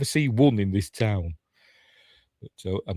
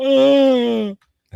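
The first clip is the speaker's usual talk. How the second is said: high, loud mock wail; hammed-up high wail; emotional wail; 8 dB louder than usual. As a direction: let out a long high-pitched "aah". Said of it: "And I'm like".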